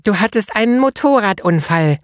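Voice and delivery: put-on high child's voice, eager; little girl. A narrator's reading. Little girl finishing the painter's sentence, high child voice. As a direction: pipe up like a small know-it-all girl. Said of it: "unterbrach mich die Kleine abermals".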